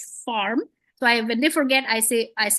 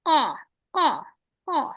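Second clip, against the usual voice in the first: mock raven cawing; 6 semitones higher than usual. Teacher imitating a raven for students, cawing. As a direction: caw like a raven, repeated. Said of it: "What's the sound of a raven".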